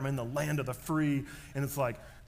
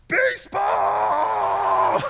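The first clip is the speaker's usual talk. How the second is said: a huge drawn-out yell, much higher and louder; yelling baseball; 14 dB louder, much slower than usual. Retold or imitated. Dad yelling as fighter jets fly over the stadium, overjoyed. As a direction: roar it out in a joyous burst. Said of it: "I just yelled".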